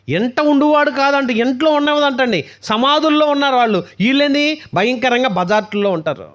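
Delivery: high, loud and slow, driving each word home; preaching at full force. Acted. Preacher warning the youth about the devil, forceful sermon. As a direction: thunder it at the congregation.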